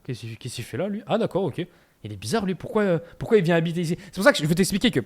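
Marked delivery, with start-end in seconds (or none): 0.1-4.0 mock puzzlement. Paraguayan women wondering about a newly arrived Frenchman, quietly puzzled. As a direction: imitate someone quietly sizing up an odd newcomer.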